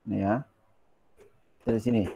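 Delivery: ordinary talk, nothing marked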